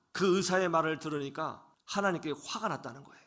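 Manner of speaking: ordinary delivery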